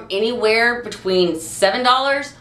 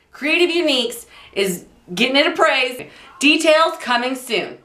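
higher-pitched, hammed-up newsreader voice; old radio announcer; 5 semitones higher than usual. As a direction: read the bulletin like an old-time newsman. Mock breaking news segment.

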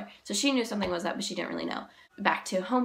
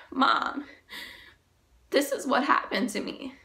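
breaking down; 6 semitones higher than usual. Real, not acted. Confessing the assault to her mom.

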